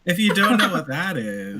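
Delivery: laughing. Real, not acted. Joking about what Asia is.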